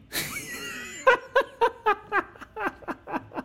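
shouting laugh. Real, not acted. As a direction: roar with laughter. Laughing at climax of story.

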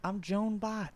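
lightly put-on high politician voice; Joe Biden. Politician announcing a new name, high mock voice. Playing along as Biden coming out.

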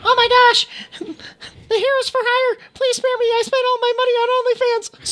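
very high, frantic begging voice; squeaky pleading rat. Acted. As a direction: squeal for mercy.

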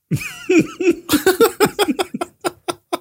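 long high-pitched giggle; high giggling laugh. Real, not acted.

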